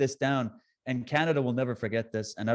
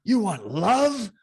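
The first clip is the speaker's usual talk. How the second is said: louder, outraged, slow on you want love; mock offended vampire; 8 semitones higher, 5 dB louder, slower than usual. Counter-protester recoiling from a hug, hammed-up outrage. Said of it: "that's the energy".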